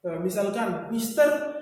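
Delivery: usual speaking voice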